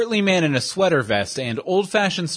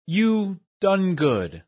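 slow, higher mimicry of a dim brute; mock dumb echo; 5 semitones higher, much slower than usual. Osbert mimics his henchman, slow and high. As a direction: parrot the brute's grammar slowly. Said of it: "Osbert said".

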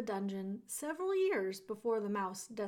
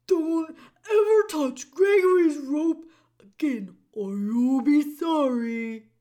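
shouting jailer; 5 semitones higher, 14 dB louder than usual. A narrator's reading. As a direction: shout the final warning. Voices Gregory the jailer.

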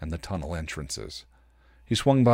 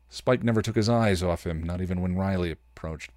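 usual speaking voice

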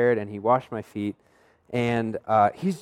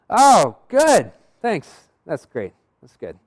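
feigned delight; 4 semitones higher, 7 dB louder, much slower than usual. Retold or imitated. Answering the friend's unwelcome news, falsely pleased. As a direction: fake being pleased, then let it sag.

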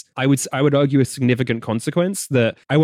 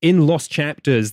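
ordinary talk

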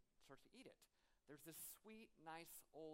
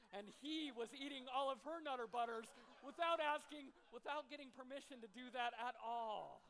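laughing through words; 8 semitones higher, 10 dB louder than usual. Real, not acted. Punchline of his own story.

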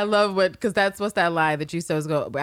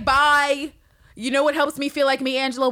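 breezy; 10 semitones higher, 6 dB louder than usual. Retold or imitated. Cal playing carefree.

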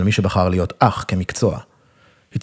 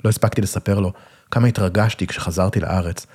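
plain reading voice

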